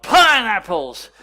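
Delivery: high, very loud, drawn-out shout; retold yell. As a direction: yell it out like an excited kid in church. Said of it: "jumped up and he yelled".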